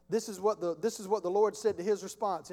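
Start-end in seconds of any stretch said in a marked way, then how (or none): none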